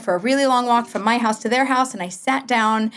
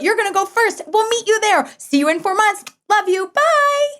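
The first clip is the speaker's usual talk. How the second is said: breezy chirpy goodbye; 10 semitones higher than usual. Retold or imitated. Breezy goodbye to her kids.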